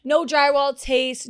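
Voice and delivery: ordinary talk, nothing marked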